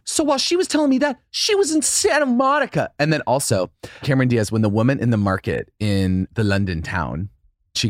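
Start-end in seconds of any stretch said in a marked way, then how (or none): 0.0-2.9 high, stretched-out quote. Retold or imitated. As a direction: say it with wide-eyed emphasis.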